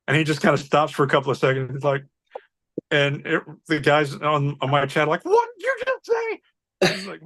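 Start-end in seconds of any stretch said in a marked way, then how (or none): 5.3-6.4 high-pitched incredulous squawk, acted out; shocked disbelief. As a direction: mimic stunned coworkers, pitch shooting way up. Chat colleagues' shocked reaction, acted.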